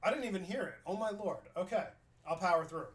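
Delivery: ordinary talk, nothing marked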